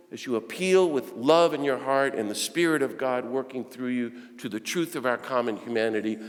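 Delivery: usual speaking voice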